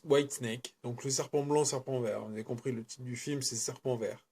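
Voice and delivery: normal talking voice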